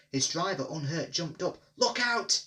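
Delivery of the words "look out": high, loud warning shout. A narrator's reading. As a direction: yell a warning. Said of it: "he shouted".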